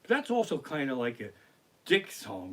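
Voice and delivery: ordinary talk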